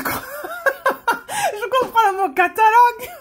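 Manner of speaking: laughing through the words; amused laugh breaking into the line. Real, not acted. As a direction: giggle while telling it. Laughs remembering understanding one word.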